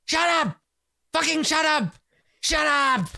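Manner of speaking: high-pitched acted yell at a dog; hammed-up, high exasperated shout. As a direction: yell to silence a yapping dog. Hermit yells at his barking dog.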